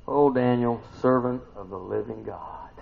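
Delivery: ordinary talk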